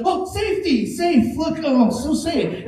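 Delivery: high, wide-eyed mock wonder; mock awed bathroom user. Comic mocking a bathroom visitor in awe, high mock wonder. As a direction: gush over how safe the filthy bathroom is.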